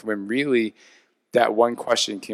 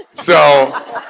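laughing; 5 semitones higher, 11 dB louder than usual. Real, not acted. Laughing at own exhaustion.

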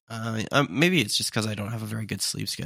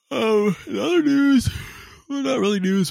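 mock announcer; 14 semitones higher, 6 dB louder than usual. Announcing next topic.

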